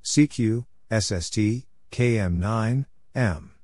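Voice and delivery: ordinary delivery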